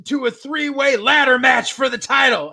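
mock challenge shout; hammed-up wrestling promo. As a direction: call out the champs like an old heel manager. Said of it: "And he's like".

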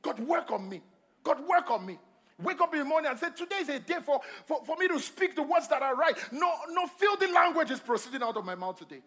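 building to high, loud declarations; fervent preaching. Real, not acted. Preacher modelling a morning prayer for clean speech, fervent preaching. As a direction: pray it out with rising force.

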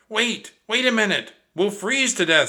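high, loud, alarmed protest. Acted. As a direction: Jack panics about freezing. Jack panics about the cold.

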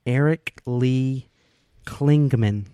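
Eric Lee Klingman, slow and loud, each syllable clear; name spelled out slowly. Shown as a demonstration. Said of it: "K L I N G M A N".

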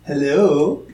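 lightly voiced little Muggsy character; a small, drawn-out hello with a hint of character. Player greets the gargoyle as Muggsy, lightly put-on little voice. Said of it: "I say in my little Muggsy voice".

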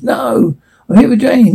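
high, urgent cry of refusal; scream. Acted. Cliff yelling at the guard for Jane. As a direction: yell it out, pushing past the guard. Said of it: "Cliff screams".

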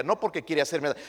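normal talking voice

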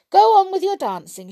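high, pensive queen voice; thoughtful queen. A narrator's reading. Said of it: "she said, looking thoughtful".

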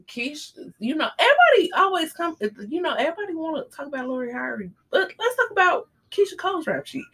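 fast, high gossipy rush; excited gossip. Real, not acted. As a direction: rattle it off, thrilled to spill.

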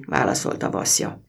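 ordinary delivery

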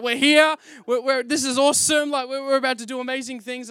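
loud, high, thrilled; excited. Retold or imitated. A church worker thrilled to be hired. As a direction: ride the excitement of the dream job.

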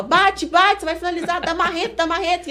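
high-pitched, urgent cornerman calls; imitated corner yelling. Coach yelling orders during the fight, imitated high urgent shouting. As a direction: mimic a coach urging blow after blow. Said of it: "Ele estava".

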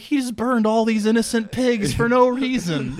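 high-pitched mock distress; mock worried protest. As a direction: protest it as if appalled, voice jumping up. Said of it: "I was worried about the same thing".

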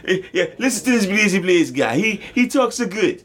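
high, laughing mock French accent. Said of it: "I keep going with it now".

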